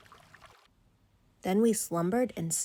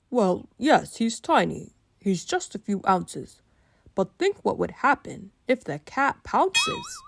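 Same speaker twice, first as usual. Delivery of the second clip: dad voice; 2 semitones higher, 4 dB louder than usual. Acted. Dad reasons with child.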